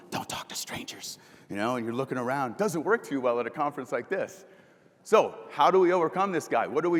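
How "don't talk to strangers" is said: a shy attendee's inner voice whispers the parents' warning; whispered inner voice. Retold or imitated. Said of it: "you got this voice going".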